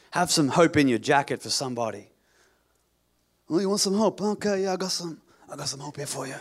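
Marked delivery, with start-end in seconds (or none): 3.5-6.3 shady street dealer offering goods; sly, secretive dealer pitch; drug dealer voice. Pretend. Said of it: "That's my drug dealer voice".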